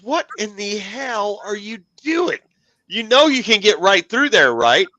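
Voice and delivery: buddy's incredulous question. Retold or imitated. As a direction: imitate Jeremy catching you doing it the hard way. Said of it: "he says".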